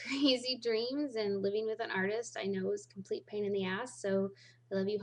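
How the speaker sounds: ordinary talk, nothing marked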